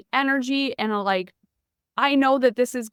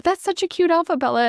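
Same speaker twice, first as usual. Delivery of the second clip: high, bright mom praise that starts louder; gushing praise; 7 semitones higher, 4 dB louder, faster than usual. Retold or imitated. Mother admiring daughter in the mirror, gushing praise. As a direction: gush over the daughter in her new outfit.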